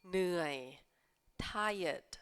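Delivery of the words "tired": tired said as one syllable, tai-ed, no rolled R; careful model of tired; pronunciation model. Shown as a demonstration. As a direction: say tired with the R after the I, not try. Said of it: "คำนี้ออกเสียงว่า tired".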